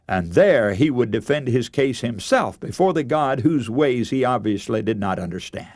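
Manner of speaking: ordinary delivery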